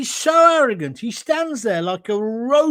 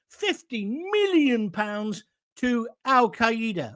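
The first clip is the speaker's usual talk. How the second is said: slow emphatic; slower than usual. Acted. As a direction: spell out "fifty million" slowly. Outraged at the money given.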